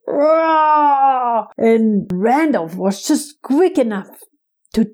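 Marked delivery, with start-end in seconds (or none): 0.1-1.5 pained roaring cry; giant roar of pain. Acted. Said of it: "the giant roared in pain".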